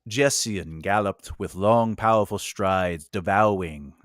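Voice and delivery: slow, measured story narration; reader's voice. Acted. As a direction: read it aloud slowly like an audiobook. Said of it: "do it in my reader's voice".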